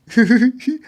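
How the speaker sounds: high, loud mock cackle of the inmate; imitated maniacal laugh.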